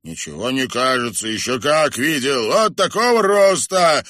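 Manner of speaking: rising to a loud high exclamation; insistent retort. A narrator's reading. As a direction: object hotly, building to the end. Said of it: "возразил Кривой Глаз".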